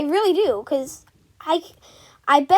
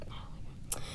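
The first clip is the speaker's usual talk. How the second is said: whispered; 20 dB quieter than usual. Real, not acted. Exasperated whispered aside.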